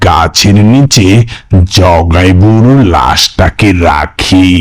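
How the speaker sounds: low, slow narrator; story narration. A narrator's reading. Narrator tells how they laid the corpse down, low and slow.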